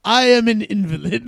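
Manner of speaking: hammed-up rich invalid voice; stroke-impaired invalid. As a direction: talk like a pampered stroke victim, put on. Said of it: "also why I'm talking like this".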